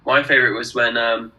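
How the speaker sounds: ordinary talk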